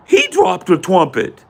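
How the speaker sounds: put-on high, slow indignant kid voice; protesting kid. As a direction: protest like a wrongly accused boy. Retold boy protesting.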